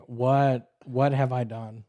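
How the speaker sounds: low, hushed what have I done; quiet dismayed inner thought. Retold or imitated. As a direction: mutter the regret softly to yourself. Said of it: "just thinking".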